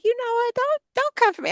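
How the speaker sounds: indignant comeback. Retold or imitated. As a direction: squeal it in mock outrage. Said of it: "And I was like".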